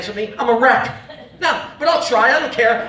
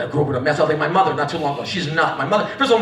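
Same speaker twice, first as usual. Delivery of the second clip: ordinary talk, nothing marked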